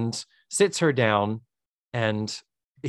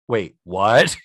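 stunned disbelief; 4 semitones lower, 5 dB louder than usual. Acted. Acted man left stunned by revelation.

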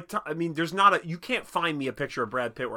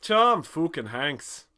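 slow, loud mock Irish, "fookin"; shitty Irish accent; 4 semitones higher, 6 dB louder, much slower than usual. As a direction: ham up a bad Irish brogue, higher. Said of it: "in a shitty Irish accent".

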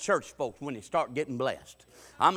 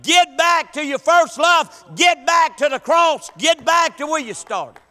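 loud, high, rhythmic preacher's cry; shouted sermon refrain; 11 semitones higher, 12 dB louder, slower than usual. Retold or imitated. Preacher shouting the sermon to the church. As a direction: hammer the refrain home. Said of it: "we shouting the same sermon".

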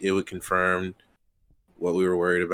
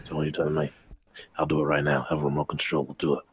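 fake British accent; 4 semitones lower, faster than usual. British Batman threatens to fetch the Batmobile, mock British accent. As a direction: lower British tough-guy grumble. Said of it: "fake accent that you're doing".

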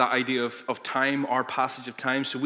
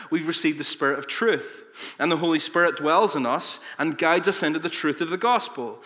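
normal talking voice

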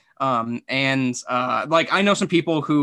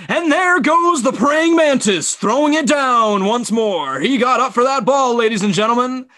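Kevin Harlan; 8 semitones higher, 5 dB louder than usual. Retold or imitated. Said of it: "Kevin Harlan is just like".

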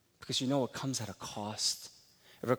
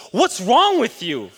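wife's exasperated question; 10 semitones higher, 14 dB louder, slower than usual. Retold or imitated. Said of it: "She goes".

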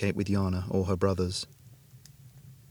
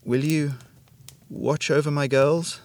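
higher, slow, strained sickbed voice; strained and imploring, pitched up; strained plea; 6 semitones higher, slower than usual. A narrator's reading. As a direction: drag out a pleading question as a dying mother. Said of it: "Lily's strained voice".

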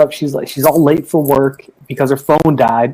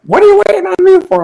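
high, fast, incredulous; quoting a wife's baffled reply, pitched up; 5 dB louder, faster than usual. Retold or imitated. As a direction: fire it back in a high disbelieving rush.

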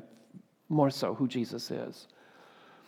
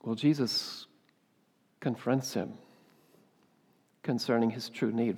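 normal talking voice

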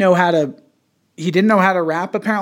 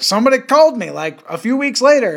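high, louder comedian impression; Dave Chappelle; 7 semitones higher, 3 dB louder, faster than usual. Podcaster retelling the comedian's phone call story, amateur impression. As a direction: tell it in a pitched-up stand-up voice. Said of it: "is that a good Dave Chappelle".

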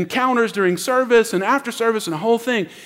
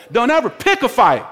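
ordinary talk, nothing marked

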